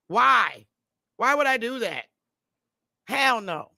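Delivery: ordinary talk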